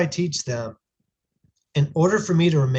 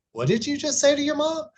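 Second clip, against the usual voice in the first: much higher, stern shocked dad confronting his son; a father stunned at his son's back talk to mom; 13 semitones higher than usual. Retold or imitated. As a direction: catch him mid-disrespect and call it out. Dad catches son talking back.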